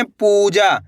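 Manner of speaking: पूजा with a long held ऊ; stretched vowels. Shown as a demonstration. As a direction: draw out पू, then जा. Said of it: "कैसे पढ़ेंगे".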